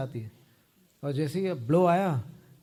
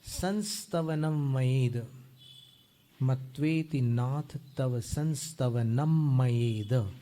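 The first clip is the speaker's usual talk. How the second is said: slow recitation; much slower than usual. Shown as a demonstration. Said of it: "teacher reciting verse slowly".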